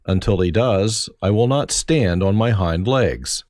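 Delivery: ordinary delivery, nothing marked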